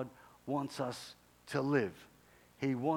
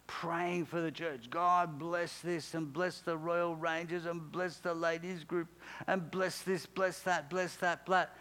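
higher, droning mock prayer; rote prayer; 4 semitones higher than usual. Johnny praying through his list for the church, droning rote prayer. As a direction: rattle off blessings in a raised monotone.

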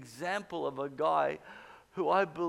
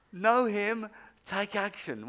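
louder, delighted reading of the slogan; excited; 3 semitones higher, 7 dB louder, slower than usual. Retold or imitated. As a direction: savour the slogan like a great discovery. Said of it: "I thought, "Wow".

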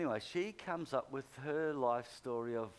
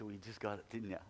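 laughing tease; 4 semitones lower, 21 dB louder, faster than usual. Retold or imitated. Pastor teasing the healed woman with a laugh. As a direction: say it through a laugh.

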